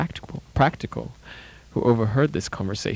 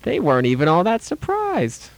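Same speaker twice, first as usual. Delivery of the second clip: amused mother; 8 semitones higher than usual. Retold or imitated. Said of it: "Her only comment afterwards will be".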